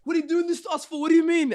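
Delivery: very high, loud indignant protest; hammed-up angry complaint; playing bad cop. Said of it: "We literally played bad cop".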